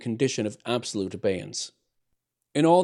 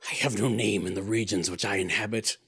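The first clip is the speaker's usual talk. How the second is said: mournful. A narrator's reading. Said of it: "replied the voice mournfully".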